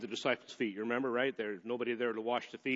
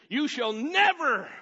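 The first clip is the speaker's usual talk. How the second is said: never stressed, high, loud and slow; emphatic never; 13 semitones higher, 10 dB louder, slower than usual. Retold or imitated. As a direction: lean on never, slowly. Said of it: "see how emphatic he is".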